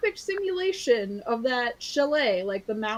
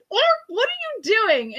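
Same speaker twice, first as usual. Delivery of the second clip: much higher, louder and fast; alarmed shout; 11 semitones higher, 6 dB louder, faster than usual. Retold or imitated. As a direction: yell it as he jumps out of the car. Family yells as he leaves.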